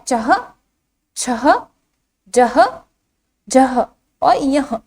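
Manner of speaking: "चह, छह, जह, झह" one at a time, each ending in h; visarga pronunciation. Shown as a demonstration. Teacher reciting the row for children, visarga pronunciation. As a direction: sound out "चह, छह, जह, झह और यह" with pauses and a final h. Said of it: "इसका उच्चारण किस तरह से किया जाता है".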